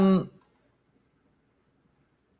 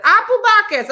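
mock loud toast; 13 semitones higher, 10 dB louder than usual. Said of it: "So he was like".

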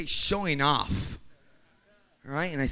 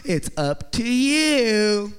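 exaggerated, drawn-out higher mimicry; louder mock deferral, stretching out the last word; noncommittal wife; 4 semitones higher, 5 dB louder, much slower than usual. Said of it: "'cause this is their way".

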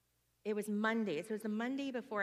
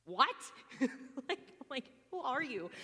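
mock surprise; 5 semitones higher than usual. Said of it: "I don't see this on an everyday basis".

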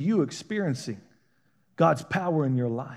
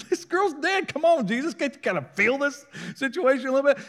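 much higher, whiny plea of a mourner; pleading mourners; 10 semitones higher than usual. Retold or imitated. Grieving family scolding Jesus, high and whiny. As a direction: plead with a hurt complaint.

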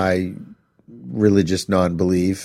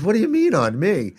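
higher, offended question; indignant; 9 semitones higher than usual. Retold or imitated. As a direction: push back in indignant surprise. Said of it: "She says".

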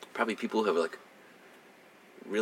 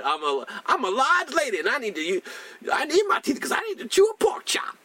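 imitating large lady again; 13 semitones higher than usual. Retelling the patient to a doubtful friend, higher mock voice. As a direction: push the brassy patient even higher. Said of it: "that's what she said".

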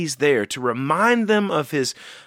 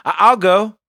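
a bold, louder eager I'll go; eager answer; 4 semitones higher, 7 dB louder than usual. Retold or imitated. Eager volunteer answering God, louder and bold. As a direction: say it like you mean it, eagerly. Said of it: "that's the attitude".

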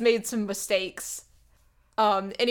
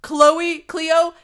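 much louder, higher call from the stage; loud stage call of her name; 8 semitones higher, 12 dB louder than usual. Retold or imitated. Acting [name] calling from stage.

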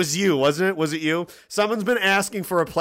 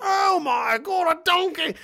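hammed-up gasp of wonder, much higher and slow; mock excitement; 4 dB louder, slower than usual. An onion farmer marvels at a runaway donkey, mock-excited and high. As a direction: act thrilled over a loose donkey. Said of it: "and you're like".

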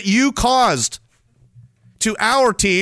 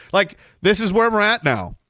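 normal talking voice

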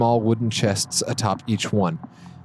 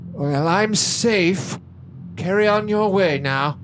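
put-on old man voice; 8 semitones higher, slower than usual. Overseer dismissing from behind door.

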